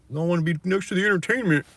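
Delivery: high, loud whiny mocking voice; mock city dweller. Ranting about city crowds, mocks them in a high whiny voice. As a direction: sneer it out like a spoiled city dweller.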